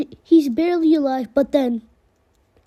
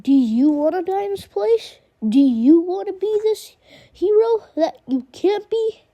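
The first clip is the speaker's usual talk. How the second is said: higher, mocking villain voice; taunting Negan voice; 5 semitones higher than usual. A narrator's reading. Negan taunting Glenn before the kill, mocking villain voice. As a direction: sneer at him like a bully with a bat. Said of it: "and Negan says".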